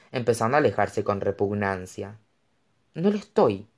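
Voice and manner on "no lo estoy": mildly indignant denial. A narrator's reading. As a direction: protest it, a touch offended. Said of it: "replicó ella indignada". (con leve indignación)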